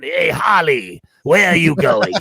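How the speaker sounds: hammed-up cartoon madman hollering, breaking into laughter; Mark Hamill's Joker. Host mocks Skeletor as Joker calling Harley, villain impression. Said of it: "he sounds exactly like the Joker".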